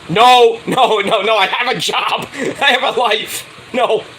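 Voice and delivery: high, insistent retold protest; emphatic mock refusal.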